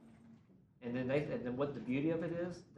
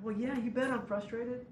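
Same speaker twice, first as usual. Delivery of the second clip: higher and louder, indignant yes; mock exasperated; 10 semitones higher, 3 dB louder than usual. Frustrated team member answering the worship leader, indignant. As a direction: push back hard, you bet I'm upset. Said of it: "They're like".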